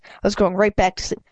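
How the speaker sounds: ordinary delivery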